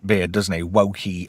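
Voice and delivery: usual speaking voice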